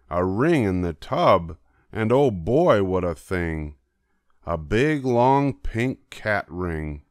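ordinary delivery

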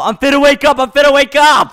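playful warning. Pretend. Dad player warns the kids he will wake, playful threat. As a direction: build excitement as a teasing warning.

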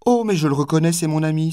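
haut et fort, ravie de le reconnaître; s'écrier joyeusement, voix montée; exclamation. A narrator's reading. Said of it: "s'écria la maîtresse". (s'écrier, ravie et plus haut)

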